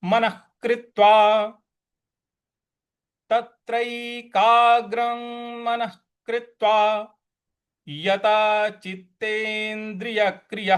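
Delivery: मनः कृत्वा with a kh before कृत्वा; visarga as kh. Shown as a demonstration. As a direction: sound the visarga as ख. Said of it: "ख का उच्चारण आएगा यहाँ पे".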